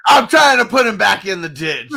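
high, very loud gleeful heckle; laughing shout. Real, not acted. Gloating at Uncle Buck about the ditch, laughing shout. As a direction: shout it over your own laughter.

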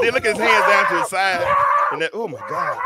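high and loud, almost breaking up; excited shouting. Real, not acted. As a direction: point it out over your own laughter. Watching the funny video clip.

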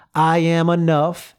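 slow, loud affirmation, each word landed; booming motivational speech. Shown as a demonstration. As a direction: proclaim it slowly like a coach rallying a crowd. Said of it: "So we're gonna start with".

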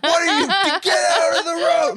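high-pitched mock mom yell; retold shriek through laughter; panicked mom. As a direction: imitate a mother yelling in alarm. Mom yelling at child in road.

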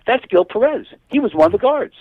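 a retold shout of sudden recognition; excited recognition. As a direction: blurt it out as if spotting a face you know. Ship's man recognizes the prisoner.

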